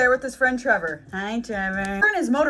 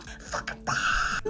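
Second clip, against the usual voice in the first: mock whisper; 8 dB quieter, much slower than usual. Mom yelling, whispered repeat.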